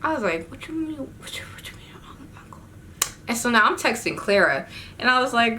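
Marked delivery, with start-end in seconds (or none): 0.5-2.6 hushed, stammering mock whisper; barely voiced, incredulous whisper; whispered disbelief. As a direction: whisper it urgently, tripping over the question. Hushed reply while hidden in closet.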